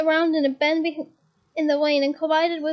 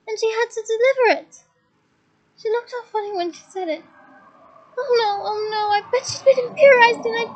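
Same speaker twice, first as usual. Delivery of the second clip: higher, tearful rushed pleading; distraught; 6 semitones higher than usual. A narrator's reading. Leanne explaining the necklace to Hermione, distraught. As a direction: spill it out in distress.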